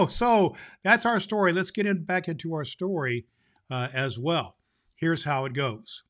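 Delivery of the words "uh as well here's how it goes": plain reading voice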